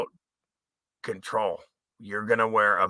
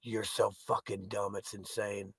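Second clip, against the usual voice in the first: quiet contempt; 16 dB quieter than usual. Real, not acted. Quiet after rant.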